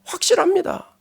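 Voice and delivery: Gyeongsang accent. Shown as a demonstration. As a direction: say 확실합니다 with a Gyeongsang accent, high and emphatic. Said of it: "경상도 말로 확실해요".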